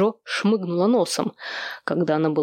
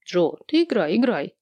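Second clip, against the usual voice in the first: lazy urging. A narrator's reading. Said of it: "лениво подстегнул сестру".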